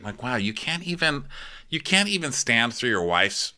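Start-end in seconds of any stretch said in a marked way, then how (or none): none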